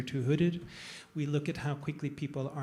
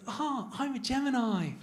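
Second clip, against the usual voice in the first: very high, put-on woman's voice; hammed-up, high-pitched comic imitation of her; mimicked bachelorette; 10 semitones higher than usual. Bachelorette answering the astrophysicist, hammed-up high woman's voice. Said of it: "she looks at him and says".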